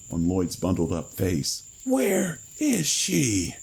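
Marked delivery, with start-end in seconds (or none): 1.8-3.6 very high, slow creature scream. A narrator's reading. Cadaver screaming at Lloyd, slow high scream. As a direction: shriek it out in torment. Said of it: "the creature screamed".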